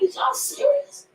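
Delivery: high, squeaky disbelief; disbelieving. Retold or imitated. Rapper to podcast hosts, retelling the offers, high squeak of disbelief. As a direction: shriek of mock amazement.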